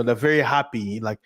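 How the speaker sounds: normal talking voice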